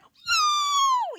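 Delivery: very high, loud drawn-out cry; shocked. Acted. Ben seeing the shove get out of control, shocked high cry. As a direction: cry out in startled alarm. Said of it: "Even Ben knows this is out of control".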